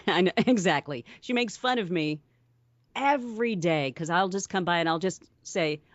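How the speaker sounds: ordinary delivery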